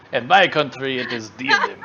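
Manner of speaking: put-on loud, jokey Russian accent; mock Russian accent. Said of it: "What was the Russian accent for?".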